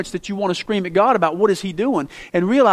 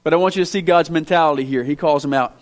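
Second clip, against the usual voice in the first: ordinary delivery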